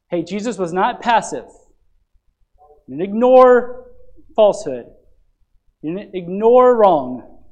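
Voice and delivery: loud, rising sermon delivery, pressing each line home; full-force preaching. Acted. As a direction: proclaim it to the congregation.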